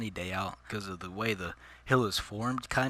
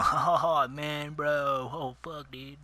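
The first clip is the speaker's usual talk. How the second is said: snowboarder impression; 4 semitones higher, much slower than usual. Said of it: "typical snowboarders, the way they act".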